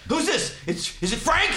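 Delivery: high, flustered mock questioning; suspicious demand. Prank victim answering a late-night call, suspicious demand.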